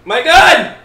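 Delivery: loud, high outburst; frustrated yell. Real, not acted. Yelling in frustration at a zombie game.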